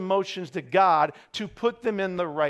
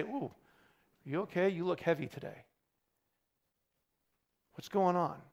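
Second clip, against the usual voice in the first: soft, hushed check-in; quietly concerned, voice dropped; gentle concern; 9 dB quieter than usual. Acted. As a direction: ask softly, as if checking on a friend. Acted concern for someone looking heavy.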